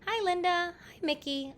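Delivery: high-pitched hello to neighbors; light storybook hail; calling out. A narrator's reading. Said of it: "I called to the Fitzgeralds".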